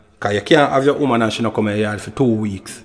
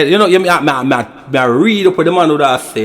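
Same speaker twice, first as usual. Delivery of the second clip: ordinary talk, nothing marked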